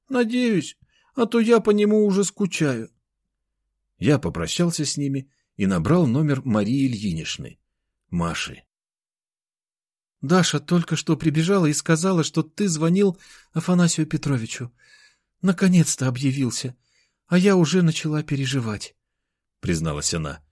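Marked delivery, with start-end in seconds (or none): 0.1-2.9 put-on high little-girl voice; wistful child, pitched well up; little sister. A narrator's reading. Little sister voiced, missing pet. 10.2-19.0 higher voice for Maria's lines; a hint of a concerned woman, pitched up; lightly voiced woman. A narrator's reading.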